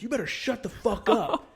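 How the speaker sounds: high, mock-threatening comeback; angry retort. Marine answering the kid's threat, angry retort.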